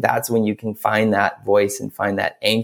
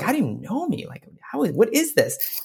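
high, softer bewildered puppet voice; puzzled Punchinello; 11 semitones higher, 4 dB quieter than usual. Acted. Punchinello questions the wood carver who knows him, high puzzled voice. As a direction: ask it small, high and baffled. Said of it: "And Punchinello's like".

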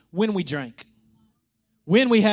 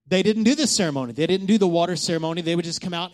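ordinary talk, nothing marked

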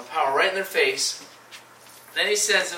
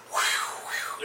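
blown wind noise; 5 dB quieter than usual. Retold or imitated. Imitating loud wind.

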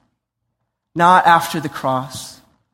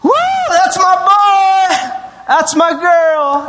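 high, loud, slow yelled cheer; bleacher shout; 13 semitones higher, 6 dB louder, much slower than usual. Pretend. Parent in the bleachers cheering their kid, loud. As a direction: yell it proudly from the bleachers. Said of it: "you're in the bleachers".

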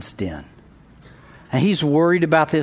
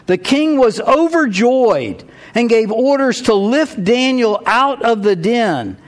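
scripture reading; 6 semitones higher, 3 dB louder than usual. Acted. Reads the rest of the story.

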